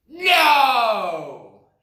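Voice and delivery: furious animals shouting at once; angry shout. A narrator's reading. The animals shout at the rabbit, furious yell. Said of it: "they shouted at last".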